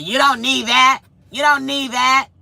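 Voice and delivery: mild mock baby-talk scolding. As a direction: imitate him talking to her like a child. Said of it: "He talked to me like I'm a baby".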